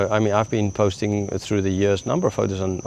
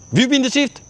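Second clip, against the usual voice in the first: incredulous retort. Retold or imitated. Pharisees retort in acted exchange.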